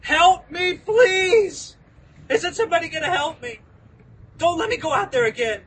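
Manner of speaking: high, loud, frantic cry for help; pretend desperate scream. Paul screaming to the Roman commander for protection from the mob, in a hammed-up desperate yell. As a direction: beg for rescue at the top of your voice, half acting it out. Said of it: "wouldn't you expect Paul to be screaming".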